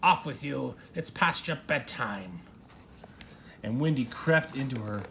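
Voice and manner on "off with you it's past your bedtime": shouted. A narrator's reading.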